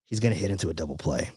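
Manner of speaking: low, soft, quick whispered aside; whispered prediction. Retold or imitated. Whispering to his girlfriend that a double play is coming. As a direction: lean in and murmur it under your breath. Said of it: "I whispered to her".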